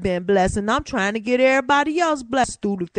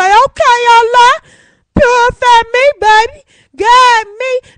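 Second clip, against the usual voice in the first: much higher, sweet and coaxing, louder; mock sweet high voice; 12 semitones higher, 10 dB louder than usual. Replying to Allah, cooing high and sweet. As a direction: coo it up at God like a sweetheart.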